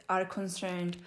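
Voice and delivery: usual speaking voice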